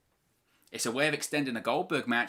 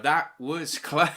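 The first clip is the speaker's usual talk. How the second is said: laughing; 3 semitones higher, 5 dB louder, much slower than usual. Real, not acted. Near fall, laughing relief.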